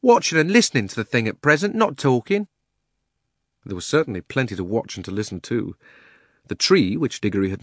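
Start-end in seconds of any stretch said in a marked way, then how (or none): 0.0-2.5 high-pitched Cockney cabby scolding. A narrator's reading. Cabby telling the uncle to watch and listen, scolding. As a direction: chide him in a raised working man's voice. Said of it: "said the cabby".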